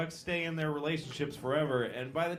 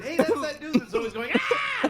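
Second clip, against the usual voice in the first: excited lion; 10 semitones higher, 6 dB louder than usual. Acted. Lion describing screaming dude.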